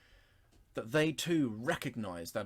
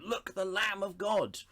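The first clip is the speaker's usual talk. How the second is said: higher, excited exclamation; excitedly calling out the Lamb of God; excited proclaim; 9 semitones higher, 3 dB louder than usual. Shown as a demonstration. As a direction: burst out with it like a discovery. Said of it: "He's excited about this".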